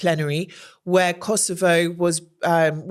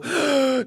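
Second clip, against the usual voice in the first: sharp put-on gasp of horror; mock shocked gasp; 7 semitones higher than usual. Mimicking a panicked presenter, a mock gasp. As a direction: gasp as if caught mortified. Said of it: "looking mortified".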